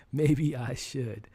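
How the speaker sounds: "Maybe I should" drawn out slowly; slow, thoughtful "Maybe I should"; slow musing. Real, not acted. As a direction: repeat "Maybe I should" slowly, mulling it over. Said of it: "but, uh, maybe I should".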